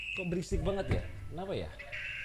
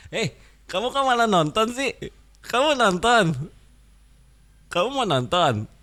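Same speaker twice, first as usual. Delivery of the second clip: put-on higher, nagging voice to a child; scolding a kid; 8 semitones higher than usual. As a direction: tell off the little one for watching. (suara tinggi menegur anak kecil)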